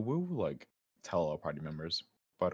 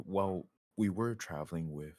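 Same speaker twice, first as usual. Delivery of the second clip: usual speaking voice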